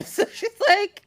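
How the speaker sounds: giggling through the words; laughing. Real, not acted.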